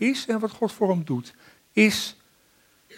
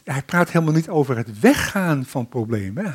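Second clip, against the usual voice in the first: ordinary talk, nothing marked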